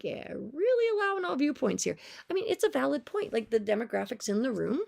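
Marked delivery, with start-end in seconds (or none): 0.0-3.3 much higher mock-bright sarcasm; sarcastic retort. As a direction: sneer it with fake approval. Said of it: "and I was like".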